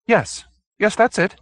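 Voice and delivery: high, quick eager confirmation; eager little man. Acted. Hoag eagerly confirming to the detective, high. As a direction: jump at being understood.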